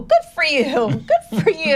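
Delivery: mock a baffled, polite well-wisher; puzzled politeness. Business peers reacting to her nonprofit introduction, puzzled politeness.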